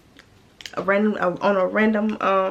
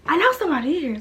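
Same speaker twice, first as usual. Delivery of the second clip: higher-pitched, mildly indignant retort; insistent comeback; 6 semitones higher, 4 dB louder than usual. Retold or imitated. Storyteller replaying her retort at the door, insistent. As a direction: push back, punching up on "know".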